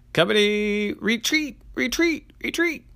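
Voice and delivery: rising high, loud retreat calls; urgent retreat. Acted. Sergeant orders retreat.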